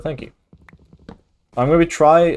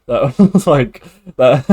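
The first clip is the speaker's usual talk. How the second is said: words breaking into laughter, a bit louder; laughing; 3 semitones higher, 7 dB louder, slower than usual. Real, not acted. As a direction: laugh through the words as it comes back to you. Laughing at own goat accent.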